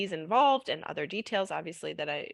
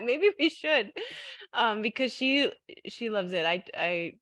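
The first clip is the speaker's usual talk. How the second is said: usual speaking voice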